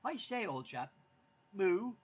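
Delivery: be a pompous British cow; posh British cow. Retold or imitated. Husband voicing a posh British cow for wife. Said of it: "what does a British cow sound like".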